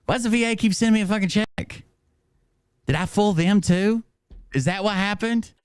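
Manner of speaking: high-pitched, incredulous questioning; mock bewilderment. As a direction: ask it high, feigning total bafflement. Mock puzzling over the checks.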